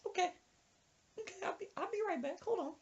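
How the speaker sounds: high, hushed falsetto; quick, softened old-lady voice up high; woman plotting revenge. Retold or imitated. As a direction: imitate her excusing herself, high and soft. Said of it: "And she's like".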